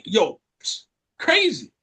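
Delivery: ordinary delivery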